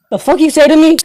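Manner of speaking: loud, high offended outburst; acted outraged challenge, pitch way up; indignant. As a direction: fire back as if insulted.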